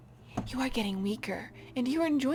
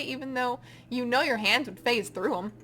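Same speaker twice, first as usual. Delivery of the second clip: normal talking voice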